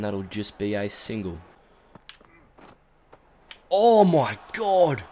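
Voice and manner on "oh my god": very slow, loud, high oh my god; stunned shout; disbelief. Real, not acted. Ball just misses stump.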